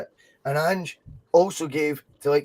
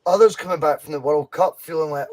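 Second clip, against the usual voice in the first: ordinary delivery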